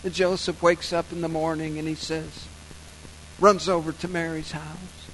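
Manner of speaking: ordinary talk, nothing marked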